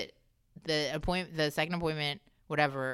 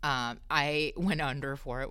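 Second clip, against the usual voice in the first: ordinary talk, nothing marked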